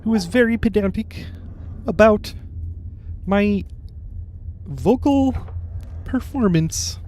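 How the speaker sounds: high Kermit voice, every syllable crisp; over enunciating. Acted. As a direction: pedantic puppet frog, carefully articulated. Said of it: "I'm going to enunciate clearly".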